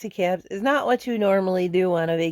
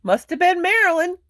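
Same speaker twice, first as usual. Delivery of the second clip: joking quip; 10 semitones higher, 4 dB louder than usual. Retold or imitated. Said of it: "And I go".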